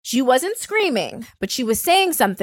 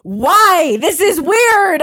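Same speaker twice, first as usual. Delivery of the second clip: baffled husband; 5 semitones higher, 6 dB louder, much slower than usual. Retold or imitated. Husband's baffled reply, retold.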